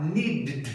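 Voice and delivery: "needed" crammed into one syllable, need-d; impossible pronunciation. Shown as a demonstration. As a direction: double the D with no vowel between in "needed". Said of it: "não tem como falar needed". ("needed" sem a sílaba extra, só D dobrado)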